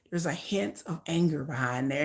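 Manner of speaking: hushed confiding whisper. Real, not acted. Whispering the hint of anger to viewers. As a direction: lean in and whisper it like a secret.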